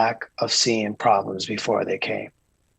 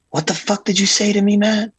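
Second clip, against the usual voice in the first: much higher, louder mock outrage; 10 semitones higher, 4 dB louder than usual. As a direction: imitate a boss blowing up, pitched way up. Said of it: "And he goes".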